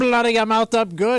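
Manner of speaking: mock Irish threat, played for laughs; Irish accent. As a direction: relish it in a stage Irish brogue. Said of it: "But it's an Irish accent".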